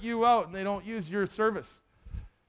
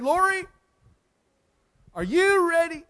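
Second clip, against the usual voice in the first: higher and louder, urgent call; earnest appeal; 7 semitones higher, 5 dB louder than usual. Retold or imitated. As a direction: rise up and press the question. Preacher pops the big question.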